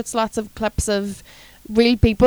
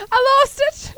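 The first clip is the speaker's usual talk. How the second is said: mock breathless lover. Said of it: "someone's like".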